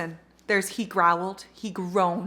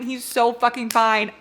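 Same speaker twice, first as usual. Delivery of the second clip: ordinary delivery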